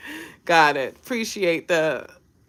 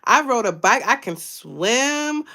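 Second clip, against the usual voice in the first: excited self-talk; 6 semitones higher than usual. Retold or imitated. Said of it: "you're like".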